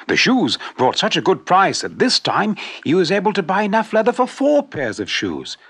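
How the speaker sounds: ordinary delivery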